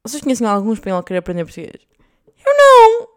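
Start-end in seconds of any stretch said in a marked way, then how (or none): 2.5-3.1 much higher, louder outcry; very high, loud mock wail; dramatic cry. Real, not acted. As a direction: shriek it in mock despair. Dramatic exclaimed answer.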